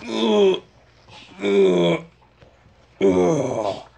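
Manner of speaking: grunting hard, in bursts; straining grunts. Pretend. Straining on toilet.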